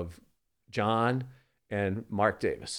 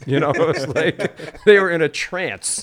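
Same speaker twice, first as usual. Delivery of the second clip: laughing; 7 semitones higher, 12 dB louder than usual. Real, not acted. Laughing through the story.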